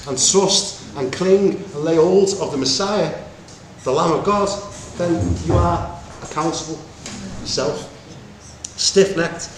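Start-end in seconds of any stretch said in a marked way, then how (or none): none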